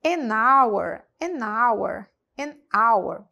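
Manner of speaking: "An hour." with no h, starting on the A sound; silent h. Shown as a demonstration. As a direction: drop the h in "An hour.". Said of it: "eu falei com som de A".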